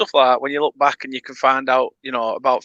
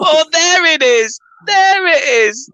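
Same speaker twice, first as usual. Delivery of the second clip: high, loud, drawn-out crowing; gleeful recognition; 6 dB louder, slower than usual. Retold or imitated. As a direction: crow it loudly, stretched and high. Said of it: "And you're like".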